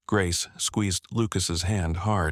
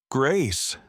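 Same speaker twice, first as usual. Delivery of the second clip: raised voice, calling her name; 6 semitones higher than usual. Acted. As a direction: call across the room. Father calls out entering.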